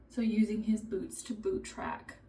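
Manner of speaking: normal talking voice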